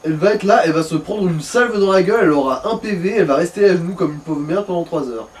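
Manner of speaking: normal talking voice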